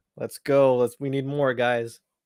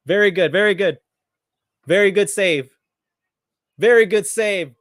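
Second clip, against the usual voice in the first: loud, high cheer for a big save; raised, punchy live-match shout; excited praise; 6 semitones higher, 8 dB louder than usual. Real, not acted.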